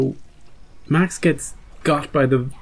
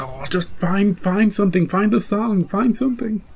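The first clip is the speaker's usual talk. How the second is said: rising higher and more frantic with each find; anxious fan begging, pitch climbing; urgent pleading; 7 semitones higher than usual. Retold or imitated. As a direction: plead at the screen, getting more desperate. Viewers urging characters to find song.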